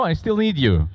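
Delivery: ordinary talk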